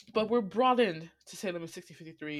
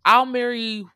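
louder, scornful retort; sassy loud retort; 4 semitones higher, 10 dB louder than usual. Retold or imitated. Winifred snapping back at the reverend, loud sass. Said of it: "she's like".

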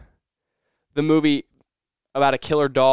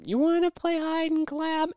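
much higher, slow eerie singsong; creepy movie voice; 4 dB quieter than usual. Retold or imitated. Friend hiding in the wardrobe plays the horror line, eerie high taunt. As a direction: taunt like a ghost child from a horror film. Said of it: "the audio from The Conjuring comes up".

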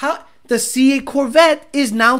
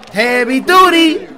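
higher, louder and slow; mock announcer voice; 5 semitones higher, 5 dB louder, slower than usual. Streamer echoing the clip's heavy duty line, slow and loud. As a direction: stretch it out like an announcer.

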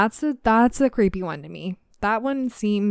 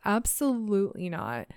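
usual speaking voice

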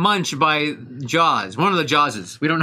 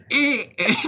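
laughing, broken dolphin clicks; 6 semitones higher, faster than usual. Shown as a demonstration. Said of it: "Mine's more like a".

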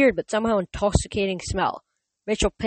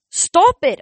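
high, much louder command; shout; 11 semitones higher, 9 dB louder than usual. Acted. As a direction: yell to stop her. Percy yells at rampaging monster.

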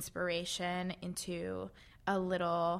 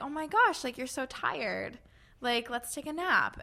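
higher, lightly voiced caring helper; fairy godmother voice; 5 semitones higher than usual. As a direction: coax them gently toward rest. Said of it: "who's that fairy godmother voice that's like".

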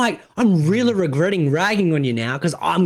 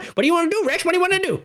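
high, yappy little-dog voice, much higher pitch; eager cartoon puppy; 12 semitones higher, much faster than usual. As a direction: bounce around like the eager cartoon pup. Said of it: "she's like that fucking cartoon with the dog".

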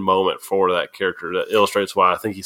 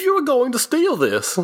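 much higher, pressing the charge; accusing character; 13 semitones higher than usual. Acted. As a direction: insist they meant to steal it. Acted anime scene, character B.

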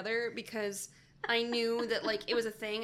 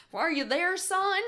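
mock worried parent; 8 semitones higher, 4 dB louder than usual. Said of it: "they're like".

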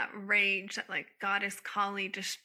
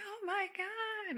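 much higher, slow, soft oh my God; blissful relief; 11 semitones higher, 7 dB quieter, slower than usual. Retold or imitated. Coming out of a cry into bliss, slow and soft. Relief after emotional release.

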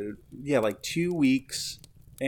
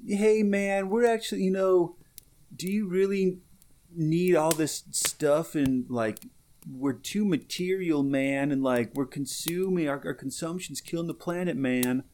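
mocking hipster voice; 5 semitones higher than usual. Mocking hipsters who preach about consumption. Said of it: "those are the same people who will say".

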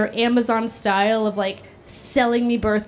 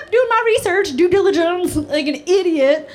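higher, lightly mocking her past self; mock diligent tone, pitched up; mock earnest self; 6 semitones higher, 4 dB louder than usual. Mocking her past self about IUD research in higher voice. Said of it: "I was like".

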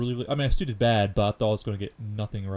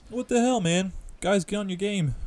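mock outrage; 9 semitones higher than usual. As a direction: scold them in a high voice. Mock outrage at game company.

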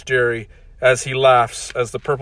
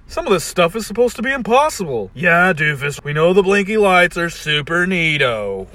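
sarcastic Rick voice; 6 semitones higher, 5 dB louder than usual. Acted. Ricks trading remarks.

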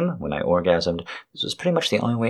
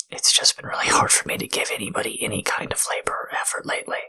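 intimate whisper. Acted. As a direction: whisper it like a tender apology.

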